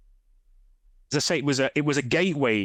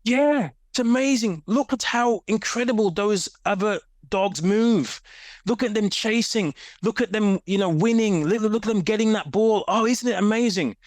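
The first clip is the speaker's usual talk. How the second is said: much higher, bright, awed dog voice; gushing talking dog; 9 semitones higher than usual. Pretend. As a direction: play a dog marvelling at the other dogs. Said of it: "And they're like".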